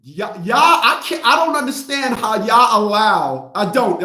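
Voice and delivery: usual speaking voice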